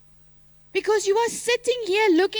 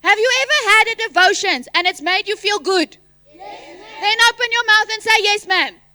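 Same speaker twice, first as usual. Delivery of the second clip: loud and higher, demanding an answer; raised voice; 6 semitones higher, 8 dB louder than usual. Real, not acted. Teacher loudly demands students answer back. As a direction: push them to respond. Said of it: "Sorry for screaming over the mic".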